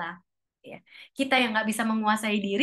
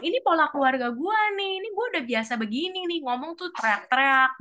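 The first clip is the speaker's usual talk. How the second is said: higher, defensive and self-justifying; defensive excuse; 6 semitones higher, faster than usual. Acted. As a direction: shrug it off as family habit. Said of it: "kita juga nggak bisa bilang". (membela diri dengan nada tinggi)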